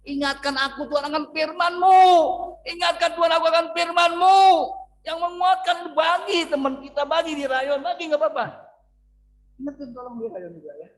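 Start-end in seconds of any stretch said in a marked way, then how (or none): 0.0-7.9 shouted prayer. Acted.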